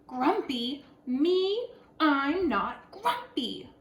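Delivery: loud and very slow, rising on Me; indignant denial. A narrator's reading. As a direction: stretch out the protest. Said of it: "said Jim".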